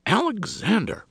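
high sharp name call; shocked. A narrator's reading. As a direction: gasp his name sharply.

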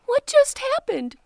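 high, loud surprised question; startled. Acted. Teeny shocked to see her brothers fall in, high. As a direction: gasp in disbelief.

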